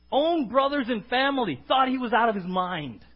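ordinary talk

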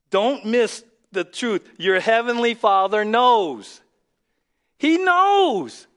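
slow, loud, rising to a high cry; preaching at full force. Acted. Pastor urging the church that the Father knows, loud preaching. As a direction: drive the truth home to the crowd.

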